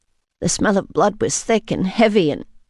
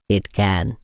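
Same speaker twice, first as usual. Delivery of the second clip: soothing hush; 11 semitones lower than usual. A narrator's reading. Vampire hushes the weeping woman, soothing.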